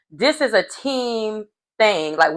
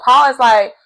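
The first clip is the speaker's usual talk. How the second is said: ordinary delivery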